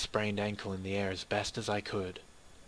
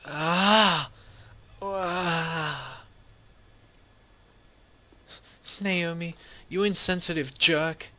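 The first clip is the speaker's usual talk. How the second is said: loud high cry, then hurling the insult; higher, louder furious yell; angry outburst; 7 semitones higher, 6 dB louder, slower than usual. Acted. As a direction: explode at her friend. Outburst at Naomi.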